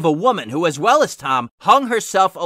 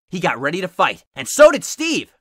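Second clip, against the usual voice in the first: ordinary delivery, nothing marked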